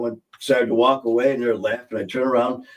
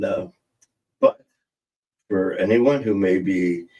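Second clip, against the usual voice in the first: normal talking voice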